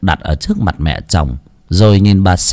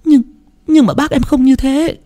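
teary. Acted. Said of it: "rưng rưng nước mắt".

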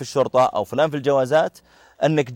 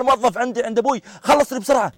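much higher, scornful put-on voice; arrogant mock retort; 11 semitones higher than usual. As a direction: sneer at the clerk as a servant. Rude passenger mocking the clerk. (رد متعجرف ساخر بصوت مرتفع)

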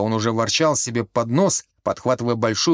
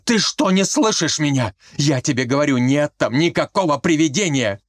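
higher, heated insistence; angry outburst; 6 semitones higher than usual. A narrator's reading. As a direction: shout him down in frustration. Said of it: "вспылил Колька".

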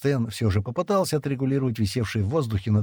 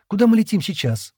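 pleased, high and louder question; 12 semitones higher, 5 dB louder than usual. A narrator's reading. Шира glad of the quarrel, asking in a pleased tone. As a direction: ask contentedly with pitch lifted. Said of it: "довольным тоном спросила Шира".

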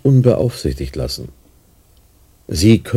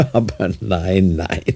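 slow, chuckling no; drawn-out amused reassurance; laughing denial; slower than usual. Acted. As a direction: laugh off the accusation smoothly. Doctor laughs off spying question.

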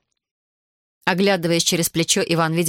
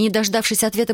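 usual speaking voice

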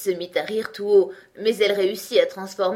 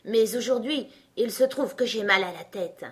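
usual speaking voice

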